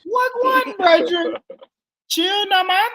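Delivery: loud, high mock Jamaican voice; squeaky put-on Jamaican greeting; high-pitched Jamaican accent. Shown as a demonstration. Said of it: "my voice always does that".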